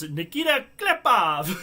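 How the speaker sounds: slow, loud, high announcer call with twang; Gary Bettman draft call. Retold or imitated. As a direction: boom the draft pick out with a twang. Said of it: "He put some twang on that".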